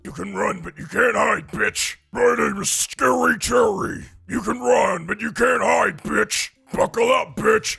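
loud growling monster shout; Scary Terry voice. Pretend. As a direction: bark it like a slasher.

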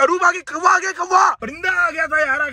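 high hammed-up yell about the crow; panicked warning shout. Husband warns driving wife of a crow, high panicked mock shout.

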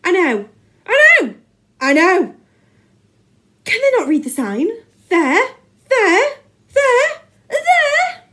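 high, loud, frantic rant; manic ranting. A narrator's reading. Teacher pointing at his playground signs, ranting manically. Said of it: "began manically pointing to the hundreds of signs".

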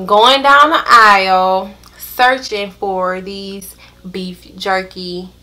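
slow, drawn-out search; slow deliberate retelling. Retold or imitated. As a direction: drag out the hunt. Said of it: "slow searching retelling".

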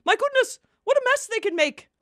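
high, quick excited gush; excited exclamation. Acted. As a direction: gush about it, high and fast. Exclaims about the mess.

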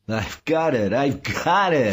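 high, rising cry of discovery; exultant. A narrator's reading. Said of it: "Saul said, laughing".

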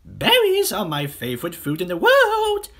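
high, loud, excited bird; singsong bird voice. Acted. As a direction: chirp it eagerly, soaring on the last word. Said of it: "sang the roly-poly bird".

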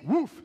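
loud, high mock woof; one sharp bark; dog bark. Dog answering the butcher, mock bark. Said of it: "says the dog".

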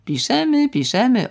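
high mocking sing-song on piszemy; piszemy mocked, rising then falling. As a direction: sneer the word piszemy up high. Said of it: "och, jak piszemy".

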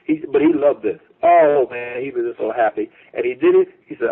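ordinary talk

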